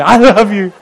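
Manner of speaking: loud, laughing mock declaration; overdone love shout. Pastor hamming up shouting love at a visitor. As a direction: belt it out like an over-keen hug. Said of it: "you don't have to say it like that either".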